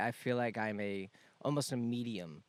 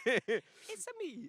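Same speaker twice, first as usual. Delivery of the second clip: high, drawn-out Mario catchphrase; hammed-up Italian plumber voice; Mario impression; much slower than usual. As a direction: stretch it out like cartoon Mario. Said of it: "I went Mario with the".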